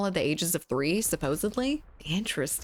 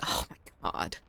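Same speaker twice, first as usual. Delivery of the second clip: whisper; 6 dB quieter than usual. Real, not acted. Reacting to a chat message.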